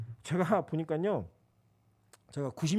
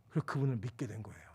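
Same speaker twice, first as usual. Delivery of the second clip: hushed whisper; 7 semitones lower, 7 dB quieter than usual. Real, not acted. Pastor confides to congregation, whispered. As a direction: drop to a whisper. Quiet aside after quote.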